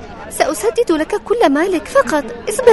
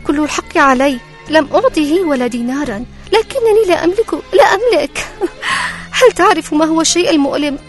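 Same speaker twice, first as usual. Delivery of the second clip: higher, pleading poor widow; 11 semitones higher, 4 dB louder than usual. Acted. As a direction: ask humbly like a poor widow. Widow talks to disguised king.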